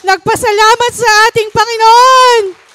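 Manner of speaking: high, loud preaching, slowing on the name of Jesus; fiery pastor proclaiming. Acted. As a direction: proclaim it to the congregation. Preaching testimony at full force.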